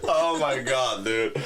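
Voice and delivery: breaking into high laughter mid-sentence; can barely get the words out for laughing. Real, not acted. Cracking up at friend's line.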